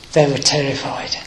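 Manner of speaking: ordinary talk, nothing marked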